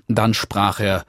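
ordinary delivery

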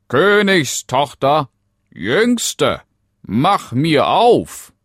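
high, loud frog call, drawn out at the end. Acted. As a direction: demand entry through a closed door. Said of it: "klopfte es an die Tür und rief". (laut und gedehnt rufender Frosch)

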